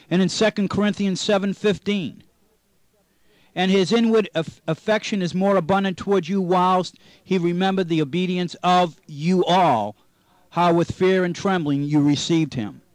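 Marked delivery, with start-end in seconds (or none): 3.5-12.8 scripture reading. Acted. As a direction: read the King James verses out like from a pulpit.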